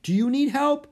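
high, loud shouted question; yelling. A narrator's reading. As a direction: offer help over the roaring wind. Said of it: "the woman yelled".

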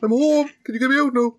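squeaky pleading hostage; high pleading voice. Pretend. Mock kidnap plea, high.

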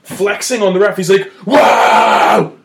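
loud, high "wow" yells; hammed-up showboating cry; imitating a flexing hothead; mock hype shout. Teammate flexing at ref.

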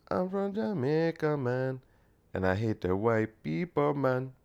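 mock Jamaican lilt, on the quiet side; Jamaican accent. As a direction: ease back into a put-on Jamaican accent. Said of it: "what would a Jamaican sound like?".

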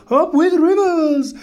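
high, loud hoot; big hooting storybook chant; hooting cheer. A narrator's reading. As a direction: hoot it out like a rallying cry. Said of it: "And they hooted".

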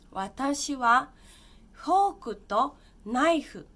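slow, loud, careful reading of the example. Shown as a demonstration. Teacher reading an example sentence to students, slow and loud.

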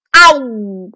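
loud "a-woo" biting noise; imitated dog chomp. A narrator's reading. Storyteller as the celestial dog biting the hero, loud chomp. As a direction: mimic a big hungry chomp.

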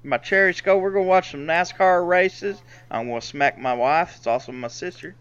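hammed-up Southern good-old-boy accent; mock hillbilly accent, dropping in pitch toward the end. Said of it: "us talking like this".

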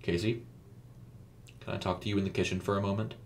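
soft, quick, controlled request; understated calm, slightly hushed. A narrator's reading. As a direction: ask quietly and evenly, giving nothing away. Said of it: "he asked calmly".

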